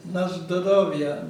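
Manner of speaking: slow "Na zdоровье", said out loud and clear; raising a glass, slow clear "Na zdоровье"; Russian toast. Shown as a demonstration. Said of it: "you say".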